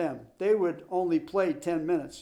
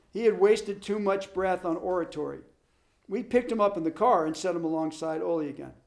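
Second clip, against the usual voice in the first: plain reading voice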